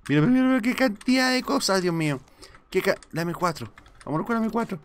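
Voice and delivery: gleeful rapid-fire mira, mira, mira; excited. Real, not acted. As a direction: gush over a pile of loot. Huge loot pile found.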